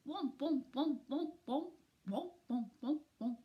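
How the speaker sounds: ordinary delivery, nothing marked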